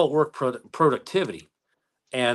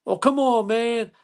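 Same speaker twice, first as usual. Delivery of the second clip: high, louder mock whine; whiny speeder; 11 semitones higher, 3 dB louder, slower than usual. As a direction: whine like a caught speeder begging off. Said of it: "And he goes".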